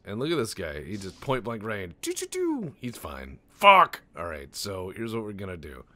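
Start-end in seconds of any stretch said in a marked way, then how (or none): 2.0-2.7 very high, loud choo-choo shot sounds; gunfire noise. Acted. Player mimicking point-blank shots, high gunfire noises. 3.5-4.0 very loud, high swear burst; frustrated shout. Real, not acted. Player seeing his target unharmed, loud frustrated shout. Frustrated at missed game shot.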